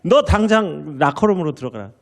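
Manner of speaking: a sharp, high shout from a furious coach; angry coach yell. Retold or imitated. Coach yelling at his player after a bad pass, angry shout. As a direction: snap at him like a fuming coach. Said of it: "불같이 화를 내는 거예요".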